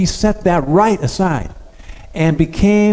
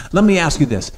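ordinary talk, nothing marked

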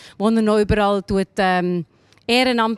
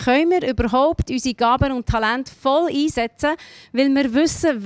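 usual speaking voice

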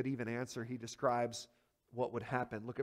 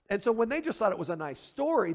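ordinary talk, nothing marked

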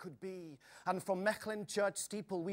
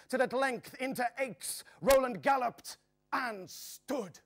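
triumphant; 7 semitones higher, 6 dB louder than usual. A narrator's reading. Triumphant arrival at Aix.

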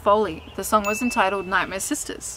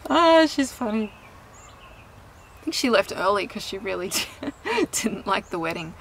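ordinary delivery

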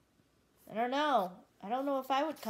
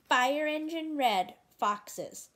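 higher, slow dramatic reveal; 5 semitones higher, slower than usual. Acted. Reading to a child, slow dramatic reveal. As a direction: draw out the big reveal.

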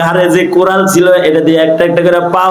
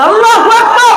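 shouted takbir. Shown as a demonstration. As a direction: shout it to lead the crowd. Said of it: "জোরে বলেন". (উঁচু জোর গলায় তাকবির)

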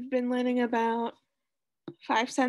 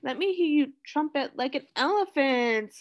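normal talking voice

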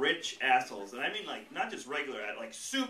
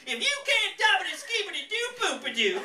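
put-on high, loud thick Southern accent; 7 dB louder than usual. Comic mocking how the sticker seemed, thick Southern accent. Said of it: "had that inflection on it".

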